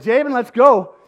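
high, loud command to leave; loud call. Retold or imitated. Dad ordering his son out past the guards, loud.

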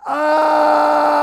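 very loud, high, long sustained scream; dying cry. Acted. Jesus crying out as he dies on the cross, full shout. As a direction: hold one huge open-vowel shout until breath runs out. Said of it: "he cries out".

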